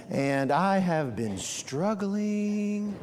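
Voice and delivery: drawn-out, very slow and soft complaint; slow rambling talker. Retold or imitated. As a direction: stretch it out, in no hurry. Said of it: "talked like this".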